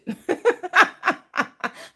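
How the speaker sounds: sudden high laugh; high burst of laughter. Real, not acted. Mocks the claim Christ never existed.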